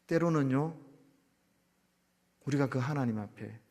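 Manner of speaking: ordinary talk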